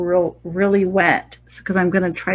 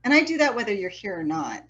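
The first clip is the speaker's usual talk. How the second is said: ordinary delivery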